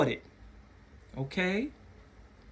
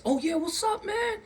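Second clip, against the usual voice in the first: high, excited buddy voice imitating strangers; overly friendly greeting; 3 dB quieter than usual. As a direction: mimic strangers fawning over a new friend.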